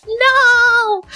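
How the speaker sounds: long, high-pitched "no"; dismayed wail. Retold or imitated. Streamer missing friend's play time, dismayed wail. Dismayed reply in retold exchange.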